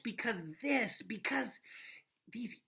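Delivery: hushed whisper. Real, not acted. Teacher whispers to the class for drama, hushed. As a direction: lean in and whisper it. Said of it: "sudden whisper".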